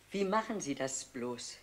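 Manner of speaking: normal talking voice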